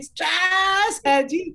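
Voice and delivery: high, long-drawn mock wail; very slow, stretched first word; imitated B G catchphrase. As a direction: mimic an audience chanting the catchphrase. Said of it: "audience after two time would start saying".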